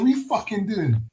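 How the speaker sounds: lighter take, a bit high; British accent demo. Shown as a demonstration.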